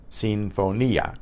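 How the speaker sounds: slow Sinfonia, seen-fo-NEE-ah; careful slow demo of Sinfonia, stress on NEE; separately pronounced I. Shown as a demonstration. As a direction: stretch Sinfonia so NEE and ah stay apart. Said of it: "I is pronounced separately".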